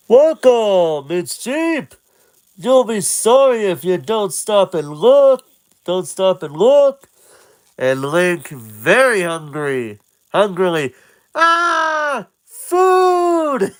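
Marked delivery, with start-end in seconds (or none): 0.1-7.1 food vendor. Acted. Vendor calls to Link, high hawker's voice. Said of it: "looks at him and says". 11.3-13.7 very high shouted cry; excited cry. Acted. Hungry Link spots food, high excited shout. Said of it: "he says excitedly".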